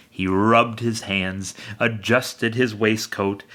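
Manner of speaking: plain reading voice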